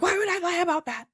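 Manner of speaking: very high, incredulous protest; imitated outraged disbelief; retold indignation. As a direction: protest in a squeaky high pitch. Retold indignant protest to his accusation.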